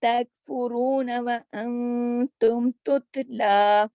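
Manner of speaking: reciting تکفرون وانتم Tatla slowly, letters held firm; slow Qur'anic recitation. Shown as a demonstration. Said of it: "کاف کو جما کے".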